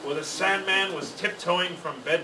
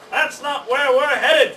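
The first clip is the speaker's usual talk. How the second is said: high, slow squawky parrot objection; fussy parrot; 8 semitones higher, 6 dB louder than usual. A narrator's reading. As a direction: squawk a protest like a nagging parrot.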